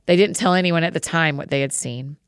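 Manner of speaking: ordinary delivery, nothing marked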